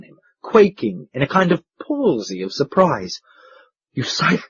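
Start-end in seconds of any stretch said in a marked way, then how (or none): 3.9-4.4 stammer. A narrator's reading. Proprietor stammers at the duke, first faltering. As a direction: trail off in shock. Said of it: "he stammered".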